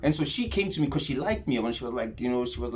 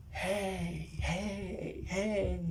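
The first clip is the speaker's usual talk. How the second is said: soft, beckoning hey, higher; whispering voices; 4 semitones higher, 9 dB quieter, much slower than usual. Retold or imitated. Trip voices calling out to him, soft and beckoning. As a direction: call to him softly like distant spirits. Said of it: "almost as if they were whispering".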